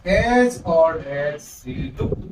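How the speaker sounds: slow, drawn-out recitation; a slow careful reading of the poem line. Shown as a demonstration. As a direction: read each phrase slowly with pauses. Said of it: "इस line को हम इस पहले वाली line से compare करते हैं".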